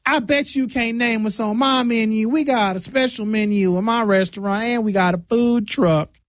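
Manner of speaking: smug, sure he'll fail; arrogant restaurant owner. Retold or imitated. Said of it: "then they get arrogant with it".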